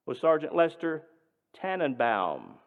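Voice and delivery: usual speaking voice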